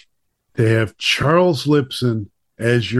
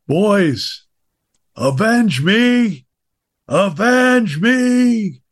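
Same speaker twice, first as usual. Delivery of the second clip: slow, high, stretched-out cry of avenge me; dramatic movie scream; 8 semitones higher, 3 dB louder, much slower than usual. Retold or imitated. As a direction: ham up a dying father's cry. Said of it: "he screamed to his sons".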